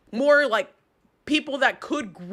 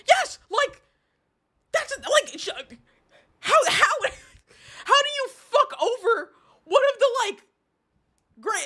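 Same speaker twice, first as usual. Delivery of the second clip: much higher, shouting with a laughing cry; angry outburst; 9 semitones higher than usual. Real, not acted. Streamer vents to chat about the company, high angry shouting. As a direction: sputter in disbelief and rage. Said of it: "but I'm mad".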